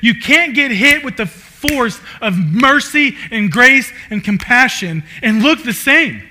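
loud, high, impassioned preaching; preaching at full force. Real, not acted. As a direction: drive the point home to the congregation.

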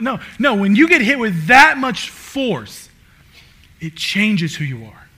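loud, high, impassioned preaching; preaching at full force. Real, not acted. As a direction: drive the point home to the congregation.

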